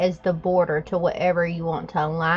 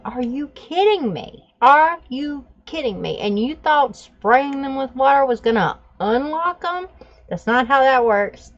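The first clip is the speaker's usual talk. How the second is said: higher and louder, disbelieving tirade; incredulous scolding; 6 semitones higher, 4 dB louder than usual. Retold or imitated. Mother scolding teens who sprayed the dogs, outraged. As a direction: let them have it, outraged.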